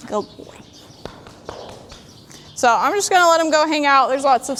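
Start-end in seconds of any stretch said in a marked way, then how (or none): 0.1-0.6 hushed, breathy praise; whispered praise. Real, not acted. Praising the horse softly.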